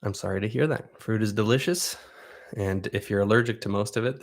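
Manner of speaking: ordinary talk, nothing marked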